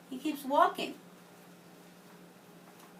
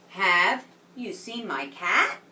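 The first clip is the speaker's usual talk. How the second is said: announcing the title; 2 semitones lower, 4 dB louder, slower than usual. Shown as a demonstration. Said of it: "the name of this story is".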